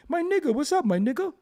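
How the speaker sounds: mock an African friend's greeting, African accent. Mocking African brothers saying it, African accent.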